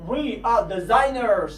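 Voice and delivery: slow We are, both words clear; careful full form We are; drawn out We are. Shown as a demonstration. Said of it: "باید شما اینها رو حتماً بدونید".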